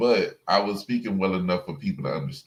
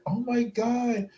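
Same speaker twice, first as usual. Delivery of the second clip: high, slow, hushed-down gasp of disbelief; mock astonishment; 12 semitones higher, 4 dB quieter, slower than usual. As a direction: stretch it out as the stunned woman. The woman learns of the mother.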